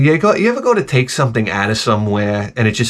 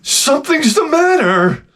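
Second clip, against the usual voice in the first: high slow nagging wife voice, much higher; mimicking wife; slower than usual. Husband mimics his wife asking what's wrong in a high slow voice. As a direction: stretch it out like a prying wife.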